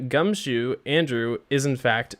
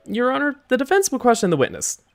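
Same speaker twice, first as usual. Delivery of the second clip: high-pitched, louder address to the judge; defense lawyer; 7 semitones higher, 3 dB louder than usual. Acted. Defense lawyer tells the judge of questioning, high-pitched declaration. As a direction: pitch it up high and announce it to the court.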